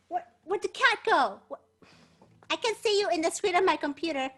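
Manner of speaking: ordinary talk, nothing marked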